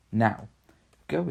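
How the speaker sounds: loud triumphant call; cried out. Acted. Lucy showing the wardrobe to siblings, a loud cry. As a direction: throw the door open and call out.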